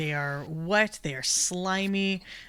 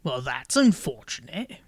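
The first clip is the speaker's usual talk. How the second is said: high, louder croaky toad voice; bored toad grumbling about his job; toad folk accent; 3 semitones higher, 9 dB louder than usual. Acted.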